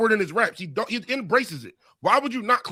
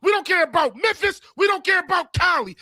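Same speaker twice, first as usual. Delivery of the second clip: raised, heated declarations; shouting; 8 semitones higher, 3 dB louder than usual. Real, not acted. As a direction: shout it proud and fired up. Heated Philly pride rant.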